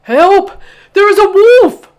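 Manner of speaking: high, loud panicked shout; terrified cry. Acted. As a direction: cry out in terror. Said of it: "cried Bubba".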